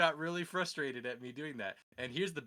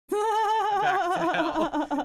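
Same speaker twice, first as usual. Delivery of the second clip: very slow, much higher and louder; dramatic drawn out; 13 dB louder, much slower than usual. Acted. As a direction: stretch every word as it vanishes. Dramatic banishment narration.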